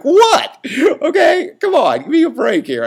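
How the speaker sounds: high, loud yelp of disbelief, easing slightly; mock-indignant protest. Retelling his reply to the sound guy's curse, mock-indignant protest. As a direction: squawk it like a wronged joker pleading innocence.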